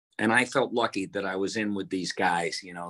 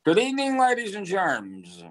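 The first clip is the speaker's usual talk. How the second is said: marble-mouthed George Jessel; 10 semitones higher than usual. Retold or imitated. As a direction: roll it around like marbles in the mouth. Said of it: "he had kind of a marble mouth".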